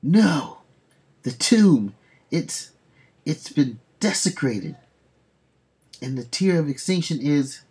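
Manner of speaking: hammed-up shocked hero; shocked exclamation. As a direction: blurt out the discovery in alarm.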